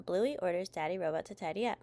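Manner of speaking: plain reading voice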